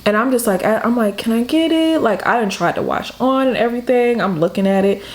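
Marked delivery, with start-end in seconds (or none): 1.2-2.0 high pleading little ask; whiny asking. Retold or imitated. Spoiled teen begging her mother for a watch, high pleading voice. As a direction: wheedle for the watch.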